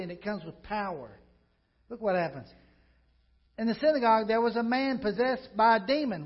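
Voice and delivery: loud, driving preacher's voice; preaching at full force. Acted. Pastor preaching the Capernaum story to his church, loud and driving. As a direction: preach it out to the congregation.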